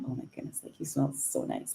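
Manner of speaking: breathy whisper of delight; whispered compliment. Retold or imitated. Whispering a compliment to Kirsty about her perfume.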